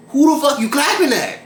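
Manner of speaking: a touch of imagined fury; mock outrage. Tired server fires back at the guest, mock outrage.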